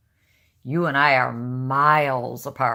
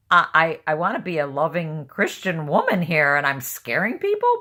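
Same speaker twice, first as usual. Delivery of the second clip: loud, raised retold disbelief; incredulous protest; 4 semitones higher, 7 dB louder than usual. Reacting to church women she scared, loud incredulous protest. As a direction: protest loudly, rising to an astonished question.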